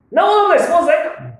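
high loud shocked no; shocked denial. Retold or imitated. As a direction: recoil at the idea. Acted reply to the rabbit's question.

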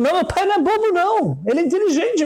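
put-on high, protesting child's voice; child defending father. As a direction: insist hard that dad isn't stupid. Child defends father to rich man.

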